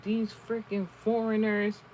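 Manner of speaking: slow, sneering mock of the rude woman; a light put-on voice, dragging the words out in contempt; mildly voiced scornful passenger; imitating bigoted rider. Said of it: "She was like".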